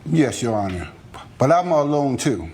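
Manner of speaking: normal talking voice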